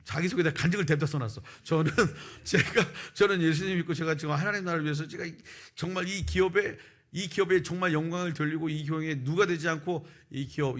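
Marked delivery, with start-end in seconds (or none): 1.6-10.8 high, fervent recital with a laugh; hammed-up testimony, pushed high and earnest; mock-zealous pledge. Parodying his zealous application essay.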